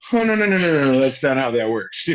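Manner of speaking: high, loud burst of no's; alarmed refusal. Retold or imitated. Manager telling her client that's not done, high and alarmed. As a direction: imitate a manager hurriedly shooting the idea down.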